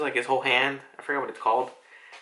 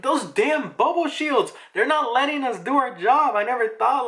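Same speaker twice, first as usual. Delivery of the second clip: frustrated yell; 11 semitones higher, 3 dB louder than usual. Retold or imitated. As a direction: vent furious frustration at the shields. Frustrated Marines complaining.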